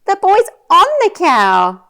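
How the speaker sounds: high, loud delighted astonishment; playful amazement. Shown as a demonstration. Showing a toddler the boy on the cow, amazed. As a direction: marvel at it for a small child.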